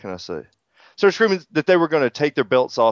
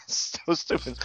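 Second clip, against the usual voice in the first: laughing; 3 semitones higher, 10 dB quieter, slower than usual. Real, not acted. Laughing at own joke.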